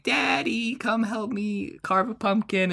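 put-on high, childlike calling out; mock child's call; little kid voice. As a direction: pitch up to play a small boy calling his dad. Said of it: "he keeps yelling".

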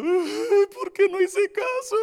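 high, loud whiny lament; mock wailing regret. Regretful congregant lamenting to themselves, high mock wail. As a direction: wail like a sorry sinner. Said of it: "va a estar llorando".

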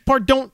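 high, mocking "par-don't" for "Pardon"; mispronounced pardon. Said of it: "you say things like".

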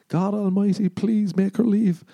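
usual speaking voice

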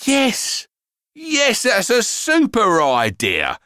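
eager agreement. Acted. Dad accepting the operator's ambulance offer, eager and relieved. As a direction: grab the idea with relief.

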